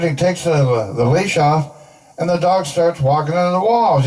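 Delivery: ordinary delivery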